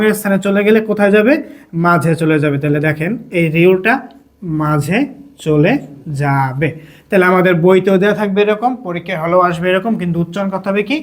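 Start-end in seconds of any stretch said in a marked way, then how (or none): 4.6-6.7 low, drawn-out words with pauses; one word at a time, as if writing; slow dictation. Shown as a demonstration. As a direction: stretch the final word out.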